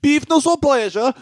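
announcer voice. Acted. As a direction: announce the title like a show host. Announcing the segment title.

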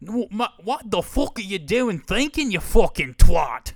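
high, shouted cockney-style insult; loud hammed-up British accent, mocking; British bloke. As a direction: yell at him like a rowdy British fan. British fan scolds the thinker.

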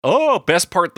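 usual speaking voice

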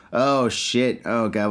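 normal talking voice

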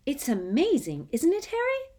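very high, gushing; excited boy. Acted. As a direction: gush with admiration. Colin gushes.

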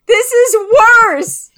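high, loud panicked cries of no; panicked wail. Acted. Panicking at the endless cotton candy.

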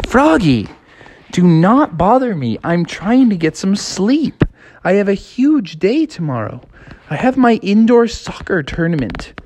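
put-on high, irritated girl voice; annoyed sister. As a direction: scold him like a cross big sister.

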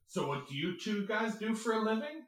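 overly chipper host voice; mock-cheerful question; imitating actor. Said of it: "then he goes".

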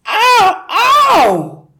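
high, very loud yell of pain; pained scream. Acted. Husband screams as his wife yanks the glued hat. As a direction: howl it out as the hat rips. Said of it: "he screamed".

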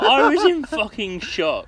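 hammed-up cry of shock; indignant outburst. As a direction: draw it out like outraged disbelief. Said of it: "And I was like".

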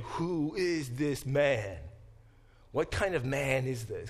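imitating amazed disciples, slow and emphatic; wondering, slowed-down retold question; retold amazement. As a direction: draw out the opening question in mild astonishment. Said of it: "they're shocked, they're surprised, out of their mind, they're amazed".

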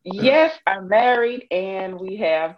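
ordinary delivery

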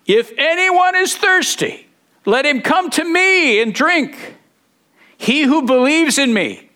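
high, ringing proclamation; crying out. Acted. Jesus calling out to the gathered temple crowd, crying out high. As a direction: raise the pitch high and call over a crowd. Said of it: "He cried out, loud voice".

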